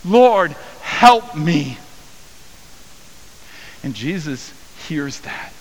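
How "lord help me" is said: loud, high cry to the Lord; pleading prayer. Acted. Woman praying before Jesus, a loud high pleading cry. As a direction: beg from your knees. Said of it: "posture of prayer".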